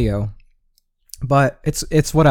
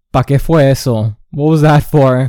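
louder, sharp mock retort from grandma; irritated grandma; 6 dB louder than usual. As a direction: throw it back at him, offended. Grandma snapping back at him.

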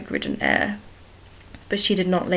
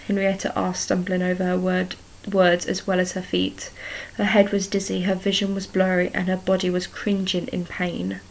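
usual speaking voice

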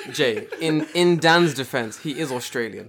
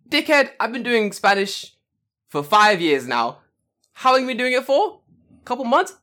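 higher, louder scornful retort; mock indignant; 7 semitones higher, 4 dB louder than usual. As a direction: put the kid down with mock outrage. Acts out scornful retort to kid.

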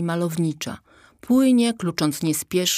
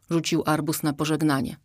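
usual speaking voice

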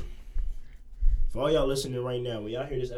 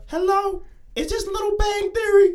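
high caller voice; 7 dB louder than usual. Pretend. Imagined caller phones the show.

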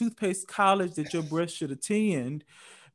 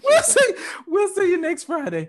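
high sing-song sign-off; 6 dB louder than usual. Acted. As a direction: lift it way up playfully.

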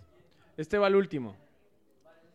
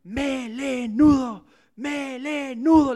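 high, slow, loud taunting "¡Melenudo!"; monkeys shouting; 7 semitones higher, 11 dB louder, much slower than usual. Acted. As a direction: jeer it up at the lion. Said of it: "le gritaban los monos". (monos gritan "¡Melenudo!" al león, fuerte y agudo)

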